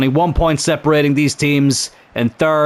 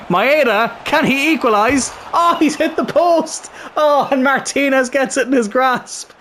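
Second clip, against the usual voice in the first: high-pitched excited play-by-play; rising thrill as the shot hits the post; real excitement, much higher voice; excited commentary; 9 semitones higher than usual. Real, not acted.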